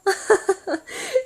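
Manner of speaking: high embarrassed giggle; giggling laugh. Real, not acted. Laughing about asking shops for a hospital: embarrassed giggle.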